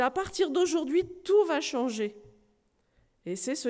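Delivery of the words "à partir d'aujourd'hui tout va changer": eager promise. Acted. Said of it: "s'écria-t-il".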